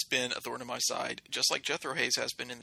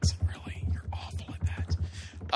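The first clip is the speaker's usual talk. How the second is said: stage whisper. Acted. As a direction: whisper it so everyone hears. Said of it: "That was a stage whisper".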